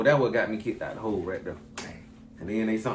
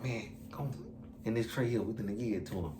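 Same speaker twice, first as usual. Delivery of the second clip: low, much softer scheming murmur; conspiratorial; 3 semitones lower, 12 dB quieter than usual. Retold or imitated. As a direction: keep it down, plotting. Coworker secretly proposing tampering.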